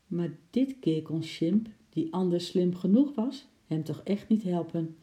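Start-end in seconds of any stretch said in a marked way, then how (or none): none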